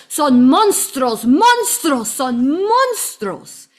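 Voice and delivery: mimicking the taxi driver's heated Spanish yell; retold yelling. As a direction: hammer out monstruos like an angry driver. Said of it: "He was yelling at me".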